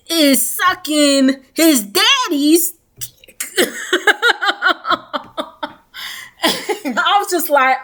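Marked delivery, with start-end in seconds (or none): 3.5-5.7 a long, high real laugh. Real, not acted. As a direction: laugh out at the twist. Laughing at the shocking twist.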